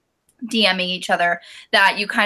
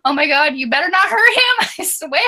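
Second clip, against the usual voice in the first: high, rising excited threat with a laugh; excited fangirl gush; 6 semitones higher than usual. Retold or imitated. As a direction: gush over the sweet boy in mock protectiveness. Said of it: "I was like".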